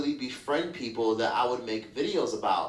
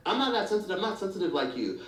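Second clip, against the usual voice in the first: mock dismissive; 6 semitones higher than usual. Voicing dismissive friends gaslighting.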